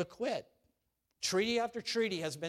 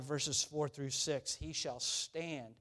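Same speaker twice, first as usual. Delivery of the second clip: normal talking voice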